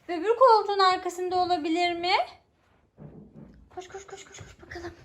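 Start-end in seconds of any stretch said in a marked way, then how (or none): 3.8-4.9 hushed urging; whispering. Real, not acted. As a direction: whisper her on to run. Urging child quietly.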